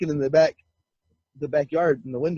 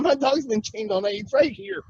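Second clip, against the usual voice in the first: lightly re-enacted protest, higher pitched; exasperated defence; 8 semitones higher than usual. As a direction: reason with your accusing uncle. Defending dog in retold quarrel.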